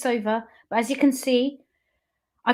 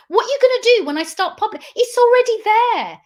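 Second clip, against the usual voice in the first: loud, fast, higher accusing threat; angry outburst; 7 semitones higher, 6 dB louder than usual. Real, not acted. Threatening her family she will go public, loud and fast. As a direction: fire it at them, rising to a shout.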